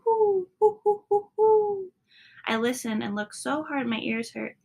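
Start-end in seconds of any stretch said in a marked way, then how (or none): 0.0-1.9 owl hoot. A narrator's reading. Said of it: "And then Pa called".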